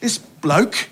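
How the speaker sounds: lightly done English bloke voice, mocking; Ricky Gervais impression. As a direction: sneer at the Bible like a cheeky Brit. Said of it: "Ricky Gervais will go like".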